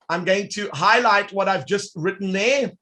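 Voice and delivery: ordinary talk, nothing marked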